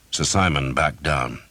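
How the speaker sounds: plain reading voice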